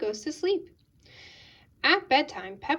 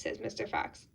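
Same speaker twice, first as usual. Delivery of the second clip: usual speaking voice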